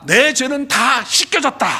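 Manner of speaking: slow, loud, proclaiming; loud declaration. Shown as a demonstration. Preacher leads the church in a declaration, slow and loud. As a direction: declare it slowly for all to repeat. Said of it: "시작".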